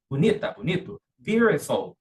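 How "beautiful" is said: "beautiful" with a tapped T; American T as R. Shown as a demonstration. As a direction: demonstrate "beautiful" as "biurefol". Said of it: "essa pronúncia é típica do inglês americano". ("beautiful" à americana, T com som de R)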